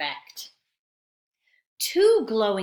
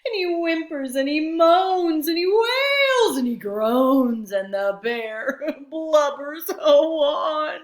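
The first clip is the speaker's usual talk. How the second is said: higher, louder hammed-up crying; mock blubbering; 7 semitones higher, 8 dB louder than usual. A narrator's reading. Teacher playfully crying out the bear's wails for the children. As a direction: wail it out like a crying bear. Crying bear, heard cry.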